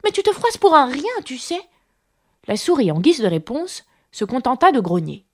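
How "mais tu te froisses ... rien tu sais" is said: apologetic protest. A narrator's reading. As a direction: plead your case, then soften. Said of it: "dit la pauvre Alice pour s'excuser". (protestation d'excuse)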